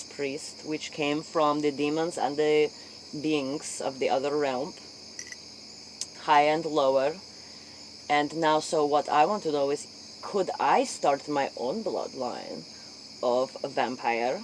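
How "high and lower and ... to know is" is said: usual speaking voice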